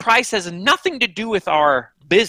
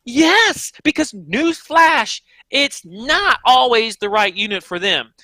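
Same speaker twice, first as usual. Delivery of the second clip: emphatic retort; 4 semitones higher, slower than usual. Retold or imitated. To the nervous manufacturer, a slow, emphatic newsflash retort. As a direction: spell out the obvious, slowly.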